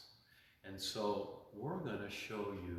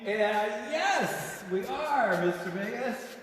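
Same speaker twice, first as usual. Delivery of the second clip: excited reply; 13 semitones higher, 11 dB louder, slower than usual. Real, not acted. Answering the call excitedly.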